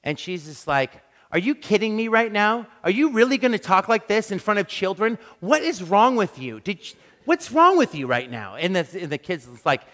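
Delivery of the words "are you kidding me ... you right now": retold scolding. Wife confronting swearing men.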